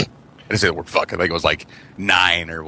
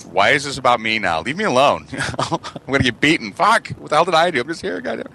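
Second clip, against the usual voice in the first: put-on higher, panicky kid's whine; 6 semitones higher than usual. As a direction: whine it like a scared kid. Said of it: "And I'm like".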